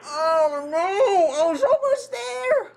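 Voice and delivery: high drawn-out cry of defeat; anguished wail. Real, not acted. Player losing right before five AM, wailing. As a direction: wail it like you just lost.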